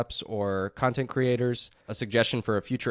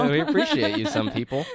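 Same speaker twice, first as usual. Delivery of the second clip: laughing; 3 dB louder than usual. Real, not acted. Laughing thanks to listeners.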